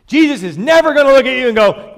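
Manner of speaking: loud, high, driving sermon voice; fiery preaching. Acted. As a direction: preach it hard, pounding the point home. Preaching at full force.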